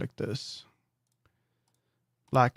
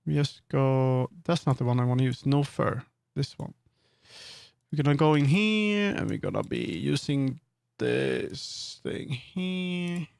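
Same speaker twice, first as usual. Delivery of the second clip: ordinary delivery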